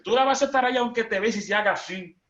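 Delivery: high, loud and quick at the groom; comic wedding sermon shouted high; mock preaching. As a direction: boom it playfully at the groom.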